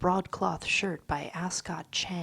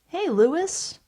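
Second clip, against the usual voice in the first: cheerful greeting; 11 semitones higher, 9 dB louder than usual. Acted.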